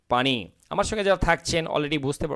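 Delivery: ordinary talk, nothing marked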